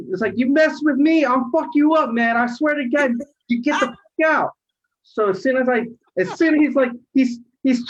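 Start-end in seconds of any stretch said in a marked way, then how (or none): 0.3-4.5 high, heated retelling of a warning; retold angry threat. Driver warning the pinned passenger, retold heated threat. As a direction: threaten him, worked up in the retelling.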